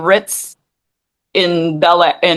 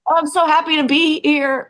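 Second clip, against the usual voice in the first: high, put-on chipper voice; sarcastic fake delight, pitched up; fake cheerful; 8 semitones higher than usual. Mocking her own forced cheer on camera, high fake chipper voice. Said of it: "Trying to perform".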